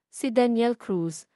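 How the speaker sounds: plain reading voice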